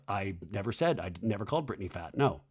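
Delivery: lightly imitated low, quiet denial; a hint of a defensive man, played down; Jack Saylor. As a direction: drop low and deny it in a hurried murmur. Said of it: "That's very Jack Saylor".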